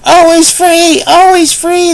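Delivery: high gleeful pig chant; joyful shout. Acted. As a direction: cheer it like a crowd of happy pigs. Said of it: "they shouted".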